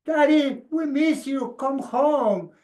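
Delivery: put-on high, small child's plea. Children calling their sick dad, high childlike plea. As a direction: sound like a little kid begging dad home.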